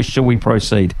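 ordinary delivery

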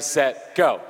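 ordinary delivery

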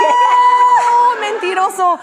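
high-pitched shout of mock outrage; outraged shout. Woman retelling catching her lying date, outraged shout. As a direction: shriek it in outrage, then come down a little.

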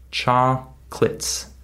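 "chocolates" split slowly into "chock... lits"; two separate syllables. Shown as a demonstration. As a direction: break "chocolates" in two beats, no middle vowel. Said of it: "it's just two syllables".

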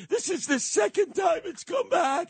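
high, shouted fake grief; mock tragic Santos. Santos to donors, hammed-up tragic shout. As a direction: cry it out like a faked relapse. Santos repeats the cancer lie.

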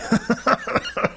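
high wheezing laughter; wheezy laugh. A narrator's reading. Dad laughing at his son's answer, wheezy amusement. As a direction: laugh in breathless bursts.

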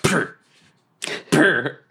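short lip-buzzed pops; fart gunshots. Acted. Goblin Junior's fart salute, buzzed pops. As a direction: pop each one like a gunshot. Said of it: "gunshot like farts".

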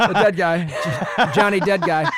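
laughing through the name; cracking up while saying it. Real, not acted. Laughing at the nickname.

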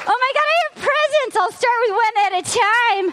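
normal talking voice

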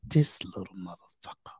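whispered under his breath in frustration; muttered curse. Retold or imitated. Dad whispering a curse about his son. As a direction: hiss it so the kid won't hear.

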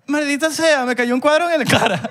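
high-pitched imitation of a hurt friend; hammed-up cursing in pain; mimicked wailing complaint. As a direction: whine it high, mocking his misfortune. Acting out the injured friend's complaint.

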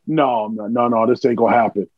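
low, muttered-down veto in retelling; firm refusal. Retold or imitated. Veteran vetoing the newcomer's plan, low and firm. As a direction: shut it down in a low voice. Ron refusing in retold scene.

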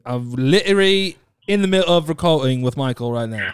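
insistent put-on British accent. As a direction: accuse in casual British slang and accent. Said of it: "you have to do British accent".

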